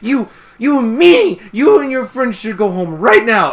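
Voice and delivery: high, loud hammed-up fury; Fluttershy rage. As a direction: imitate a timid pony exploding in outrage. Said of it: "Fluttershy goes into rage mode".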